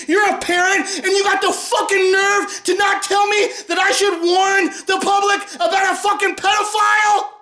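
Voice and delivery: high, raging yell; furious shout. Real, not acted. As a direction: accuse the parent at full volume. Yelling at a critic.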